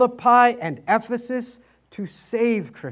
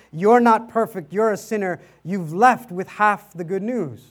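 ordinary delivery

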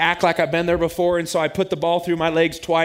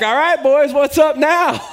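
much higher, louder triumphant taunt; cocky victory shout; gloating trash talk; 9 semitones higher, 7 dB louder, slower than usual. Retold or imitated. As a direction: crow at the boys after the shot. Trash talk after hitting shot.

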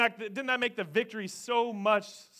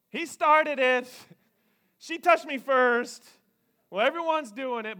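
put-on high, loud child whining; a kid blaming his sibling, high and whiny; 7 semitones higher, 9 dB louder than usual. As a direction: play a child making excuses. Voicing kids' excuses.

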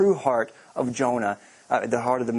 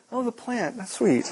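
mock delight; 9 semitones higher than usual. Jonah suddenly happy about the shade plant, mock cooing. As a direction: coo over it sweetly. Jonah's mood swing mocked.

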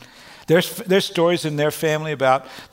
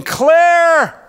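high, loud joyful call; Jesus calling the all-clear, high and loud; 11 semitones higher, 8 dB louder than usual. Retold or imitated. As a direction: ring it out like an all-clear signal. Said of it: "and he's saying".

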